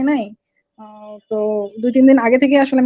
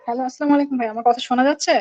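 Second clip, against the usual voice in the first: repeated a bit louder; 4 dB quieter, faster than usual. Real, not acted. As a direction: speak up for the caller. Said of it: "একটু জোরে বলতে হবে ভাই।".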